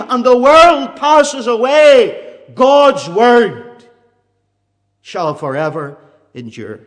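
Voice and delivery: loud fiery preaching. Acted. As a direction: thunder it from the pulpit. Preaching at full force.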